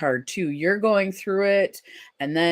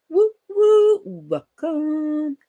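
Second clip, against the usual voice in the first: high, whooping welcome; high celebratory hooting greeting; excited cheer; 11 semitones higher, 6 dB louder, much slower than usual. Real, not acted. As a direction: whoop it out, then draw out the welcome. Cheering a newcomer into the stream.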